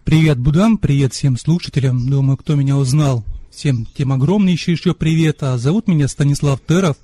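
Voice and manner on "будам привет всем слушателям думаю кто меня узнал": ordinary talk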